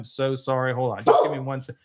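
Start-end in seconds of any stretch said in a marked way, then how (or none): none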